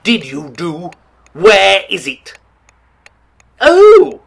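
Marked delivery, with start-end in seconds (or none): 3.6-4.2 much higher, much louder sudden yelp; surprised outburst; startled cry. Acted. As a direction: jolt of alarm at the failed light. The traffic light goes out.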